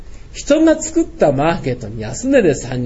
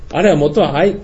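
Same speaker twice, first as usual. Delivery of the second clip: ordinary delivery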